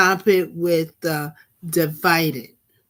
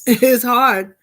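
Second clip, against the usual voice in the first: laughing; 5 semitones higher, 5 dB louder than usual. Real, not acted. Laughs admitting Trump is hard.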